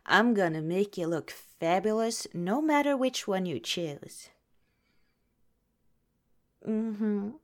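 ordinary delivery

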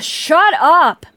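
a sigh, then a sudden loud, high yell; sudden shout. Acted. Captor losing patience with the victim, shouting. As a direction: explode at him without warning.

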